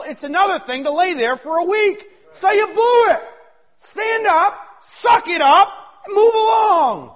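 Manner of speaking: loud, high, driving sermon; full-force preaching. Acted. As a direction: preach it hard to the congregation.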